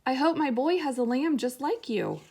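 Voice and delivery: backhoe voice. Acted. Backhoe's fond farewell.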